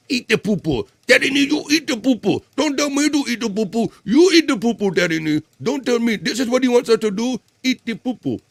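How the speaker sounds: silly high voice. Acted. Streamer voicing a bratty silly character, high squeaky baby talk. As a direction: whine it like a cartoon toddler. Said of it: "I'll say".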